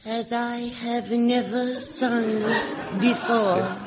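mock hypnotized reply; trance repeat. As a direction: repeat it like a sleepwalker. Hypnotized girl repeats command.

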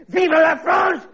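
high, slow, shouted rallying cry; French accent, proud cry; patriotic shout. Acted. French official ending his rant, shouted patriotic cry.

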